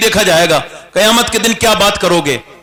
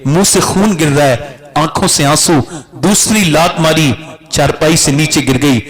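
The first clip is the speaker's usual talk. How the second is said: ordinary delivery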